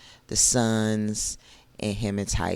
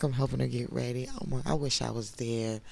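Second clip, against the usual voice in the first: usual speaking voice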